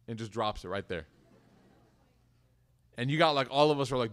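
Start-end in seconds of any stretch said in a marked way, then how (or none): none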